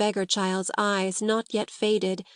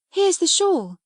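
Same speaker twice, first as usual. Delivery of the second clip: high exclamation of discovery; delighted cry; 10 semitones higher, 4 dB louder than usual. Acted. Sarah shows Becky the shawl she found, crying out happily. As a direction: pounce on a find gleefully. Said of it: "she cried".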